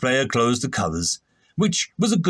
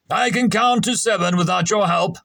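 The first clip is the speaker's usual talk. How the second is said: angry; 6 semitones higher than usual. A narrator's reading. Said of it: "Shrub told him angrily".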